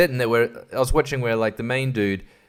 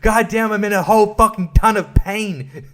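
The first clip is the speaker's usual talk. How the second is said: high, loud hammed-up cries of pain; mock pained howling; 8 semitones higher, 5 dB louder, slower than usual. Imagining how the burnt girl should scream, in hammed-up agony. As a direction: overact a burnt leg, yelling in mock agony. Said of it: "she's just not like".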